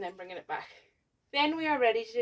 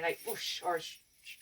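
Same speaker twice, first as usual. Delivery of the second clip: whispered whoosh noise; breathy sh sound effect; 5 semitones lower, 7 dB quieter than usual. Shown as a demonstration. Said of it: "compare to closing the car door".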